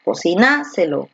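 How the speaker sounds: Cocináselo stressed on NA; stress on third syllable. Shown as a demonstration. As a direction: hit NA in Cocináselo. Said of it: "¿Dónde está la fuerza de voz".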